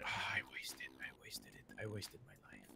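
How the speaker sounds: hushed, defeated whisper; despairing whisper. Retold or imitated. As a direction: breathe it out like you just lost everything. Despair over losing a life.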